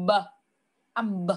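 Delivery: "B" pronounced as a short phonics sound; letter sound demo. Shown as a demonstration. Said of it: "khi nào con phát âm cái chữ này con sẽ đọc là âm".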